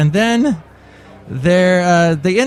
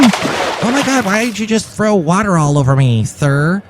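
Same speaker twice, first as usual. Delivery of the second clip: higher-pitched affronted character; indignant commercial mom; 6 semitones higher than usual. Acted. Commercial mom doused with water by floor cleaner, indignant. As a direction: protest at being suddenly drenched. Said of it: "one of the actresses".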